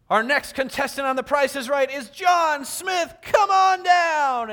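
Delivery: showy announcer shouting a name; game show announcer. Retold or imitated. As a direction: belt it like a TV game show host. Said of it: "the announcer comes on and says".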